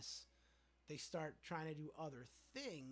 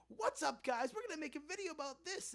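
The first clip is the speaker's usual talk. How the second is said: much higher and louder hyped "what's up guys"; mock YouTuber intro; 12 semitones higher, 8 dB louder than usual. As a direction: ham up a generic YouTuber opener. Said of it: "They just go".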